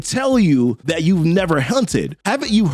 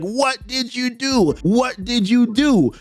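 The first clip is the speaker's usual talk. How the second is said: high, slow, drawn-out angry demand; pissed-off retelling; 6 semitones higher, slower than usual. Retold or imitated. Peter going to town on Craven, pissed off. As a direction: pitch it up and stretch each word, furious but not shouted. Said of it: "dude is pissed".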